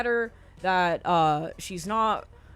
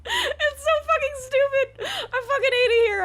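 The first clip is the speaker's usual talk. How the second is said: laughing and crying; 6 dB louder than usual. Real, not acted. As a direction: laugh helplessly at how stupid it is. Laughing mid-rant at absurdity.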